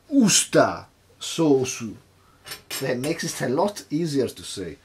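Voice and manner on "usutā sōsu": "usta sauce" slow and loud, the Japanese way; Japanese pronunciation. Shown as a demonstration. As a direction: spell out "usta sauce" syllable by syllable, Japanese-style. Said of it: "in Japan, it's called".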